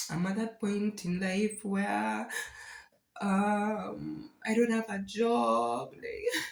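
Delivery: high, halting, tearful voice; real fight against tears, pitch climbing until it breaks; trying not to cry. Real, not acted. Confessing to viewers about being jobless, trying not to cry. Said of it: "I'm really trying not to cry".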